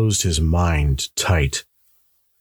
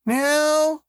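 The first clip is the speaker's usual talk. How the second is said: put-on much higher child's voice; boy's voice. A narrator's reading. Son calling back to his mother, boyish. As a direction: answer as a young son. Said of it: "Tommy's voice came".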